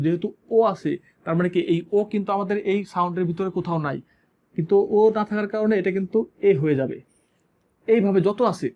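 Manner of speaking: usual speaking voice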